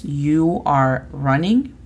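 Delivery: slow, carefully spaced English for learners; slow model phrase. Shown as a demonstration. Teacher modelling English for Spanish learners, slow and clear. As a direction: sound out each word slowly like a teacher. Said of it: "La podemos utilizar con verbos".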